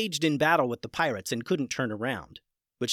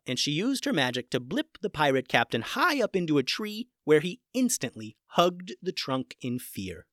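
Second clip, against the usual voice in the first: ordinary delivery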